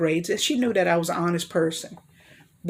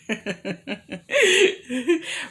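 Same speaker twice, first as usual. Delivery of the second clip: chuckles building to a high burst; helpless little run of laughter; real laugh; 6 semitones higher than usual. Real, not acted. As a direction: break into a real laugh between remarks. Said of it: "Forgive my laugh".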